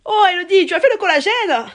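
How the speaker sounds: aigu et fort, collègue du Sud qui charrie; Marseille accent. Retold or imitated. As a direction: imite la collègue marseillaise moqueuse. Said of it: "ma collègue marseillaise". (accent marseillais moqueur)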